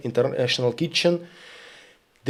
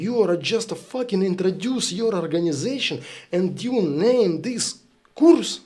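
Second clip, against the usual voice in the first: retold angry retort; 5 semitones higher than usual.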